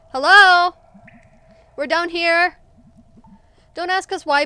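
high, projected call. Acted. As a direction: shout up to someone far above. Stuck characters call up for help.

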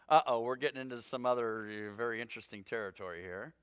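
low, soft uh-oh aside; wary aside. Real, not acted. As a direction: drop down as if entering tricky ground. Mock-wary aside on tricky passage.

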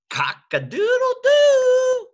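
high, loud, stretched rooster cry; rooster crow. Shown as a demonstration. Grandpa shows the kids his rooster, loud high crow. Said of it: "That's how I do a rooster".

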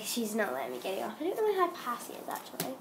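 usual speaking voice

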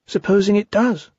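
high little questioning voice; Piglet anxious. Acted. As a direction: ask as a worried small animal. Piglet anxiously asking Owl.

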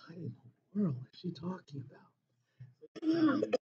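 hushed, under the breath; puzzled aside. Acted. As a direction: say it softly to yourself, baffled. Student's puzzled aside during bank story.

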